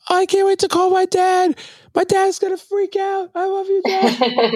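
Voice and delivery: very high excited gushing; reenacted high-pitched giddy excitement; overjoyed squeal. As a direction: squeal it all out, stretching the end. Reenacting excited words on stage.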